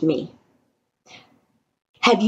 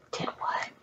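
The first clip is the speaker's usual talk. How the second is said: whispering; 17 dB quieter than usual. Acted. Storytelling suspense.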